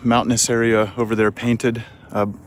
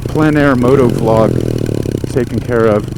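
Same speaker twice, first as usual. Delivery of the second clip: inside a motorcycle helmet; 10 semitones lower, 4 dB louder than usual. Real, not acted. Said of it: "my helmet mic on the way back".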